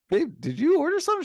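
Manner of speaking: very high, quick, loud query; retold excited question. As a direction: act out calling to your wife about a surprise. Acts out asking wife about package.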